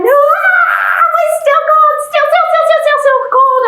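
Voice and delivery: high, shivering cry of cold. Pretend. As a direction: squeak it out, freezing.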